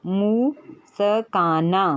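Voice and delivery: मुस्काना drawn out very slowly, syllable by syllable, मु-स-का-ना; slow spelled-out word. Shown as a demonstration. Teacher sounding out मुस्काना for a student, very slow, syllable by syllable. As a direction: stretch मुस्काना out, sounding each part for the child. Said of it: "जैसे है".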